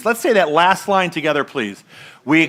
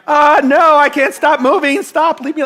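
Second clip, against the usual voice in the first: retold gasping plea, higher and louder; panicked protest; 7 semitones higher, 3 dB louder than usual. Reliving ambulance scare for congregation, higher panicked plea. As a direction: push the helpers away in mild mock panic. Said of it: "and I'm like".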